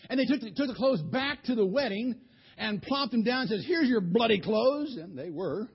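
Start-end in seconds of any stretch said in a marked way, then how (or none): 3.7-5.0 angry retort. Acted. Throwing clothes back in anger.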